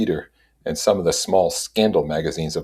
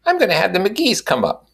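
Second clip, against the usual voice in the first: high, proud announcement; announcing. A narrator's reading. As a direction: declare it grandly. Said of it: "she announced".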